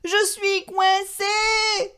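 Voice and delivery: high, slow wail; Papa shouting. A narrator's reading. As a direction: stretch it out, stuck and desperate. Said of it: "crie papa".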